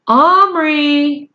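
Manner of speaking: mother calling her son from downstairs. Acted.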